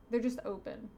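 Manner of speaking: ordinary delivery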